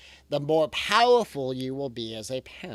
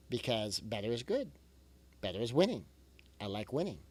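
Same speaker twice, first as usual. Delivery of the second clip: quieter, gently encouraging; a calm closing reminder, much softer; soft reassurance; 5 semitones lower, 7 dB quieter than usual. Real, not acted. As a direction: ease the listener down softly.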